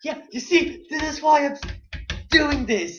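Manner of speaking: put-on high, loud little kid voice; four-year-old voice. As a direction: squeal it like a small child. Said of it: "I'm just acting like a four-year-old".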